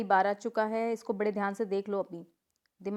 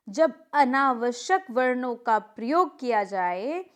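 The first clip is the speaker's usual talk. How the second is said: slow dictation; 3 dB louder, slower than usual. Shown as a demonstration. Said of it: "teacher reads the rule slowly".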